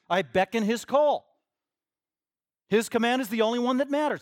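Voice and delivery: loud, high, declaring each statement firmly; emphatic preaching. Real, not acted. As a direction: proclaim it like a preacher at full force. Preaches at full force.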